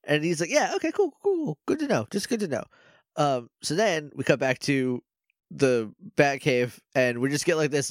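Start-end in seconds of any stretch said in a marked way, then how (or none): 0.5-2.6 high, breezy cool cool cool; Mac's airy brush-off. Retold or imitated. Recapping Mac's blasé reply to the Misers, high and breezy. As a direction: toss it off like a bored teen.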